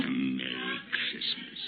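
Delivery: low derisive mutter; scornful echo. Acted. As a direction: spit the words back with disdain. Scrooge scoffs at nephew's wish.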